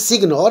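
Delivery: "signor" with a hard g sounded, sig-nor; mispronounced signor. Shown as a demonstration. Teacher showing students the mistaken sig-nor reading of "signor", hard g sounded. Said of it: "That is not signor".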